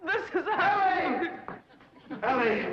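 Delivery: high, loud and slow, swept away by love; gushing entrance. Acted. Lovestruck girl announces herself to her beloved, high loud gush. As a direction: burst in breathless with ardent joy. Ellie bursts in under a charm.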